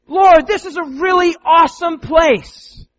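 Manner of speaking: high, loud, slow gushing; loud starry-eyed Peter; excited Peter. Acted. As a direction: gush like an overeager disciple. Peter voiced blurting out.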